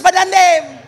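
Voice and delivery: high, loud shout of a name; calling out. Real, not acted. Preacher hailing the event organiser from the stage, calling out. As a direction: call out to the organiser, pitched high. Calls out to organiser mid-sermon.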